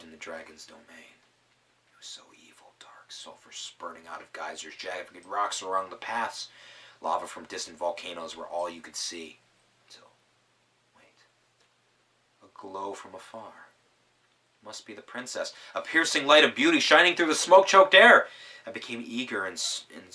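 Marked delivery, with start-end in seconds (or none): 1.9-4.8 eerie hushed whisper; whispered dread. A narrator's reading. Narrator describes the dragon's domain. 15.7-18.3 high, loud, wonderstruck; awed and loud. A narrator's reading. Narrator marvels at the princess's light.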